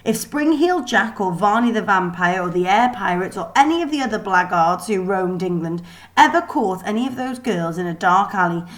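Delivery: plain reading voice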